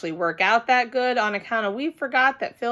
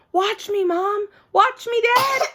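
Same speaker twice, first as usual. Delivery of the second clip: high, loud eager call; child calling her parents to watch; 11 semitones higher, 4 dB louder than usual. A narrator's reading. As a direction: show off to mom and dad. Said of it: "calling to parents".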